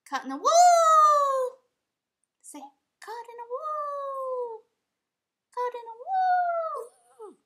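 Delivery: high, slow, loud drawn-out chant; sing-song for the dog. Pretend. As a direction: stretch wall way out, as if the dog could sing along. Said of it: "Can you say it with me?".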